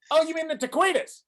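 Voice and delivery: high, loud Aussie correction; Australian accent, pitched well up. Retold or imitated. Australian waiter corrects the customer, Australian accent. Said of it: "impressed by [name]'s Australian accent".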